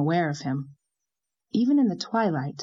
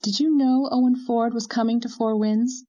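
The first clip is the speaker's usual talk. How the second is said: higher, pressing reproachful question; 5 semitones higher, 4 dB louder than usual. A narrator's reading. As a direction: put the question as a raised-pitch reproach. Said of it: "in a low, reproachful voice".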